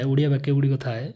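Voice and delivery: ordinary delivery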